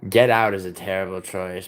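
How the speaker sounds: ordinary delivery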